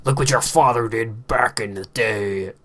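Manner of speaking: slow, put-on voice to the kids; mock proud father. Vlogger to his future children, mock-proud father. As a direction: draw it out as a boasting dad. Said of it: "when my children were like".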